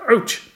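very high, loud yelp; sharp squeal of pain. A narrator's reading. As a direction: cry out at a sudden bump. Hamster slides across cage floor.

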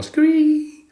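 loud, very high frog squeal; screamed frog squee. A narrator's reading. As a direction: let out a piercing frog cry. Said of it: "Og repeated".

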